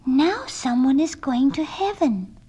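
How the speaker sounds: lightly voiced little girl. A narrator's reading. Girl watching a falling star, lightly voiced wonder. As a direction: gently read as a small child. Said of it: "said the little girl".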